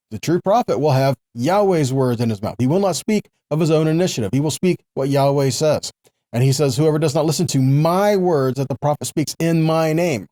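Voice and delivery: ordinary delivery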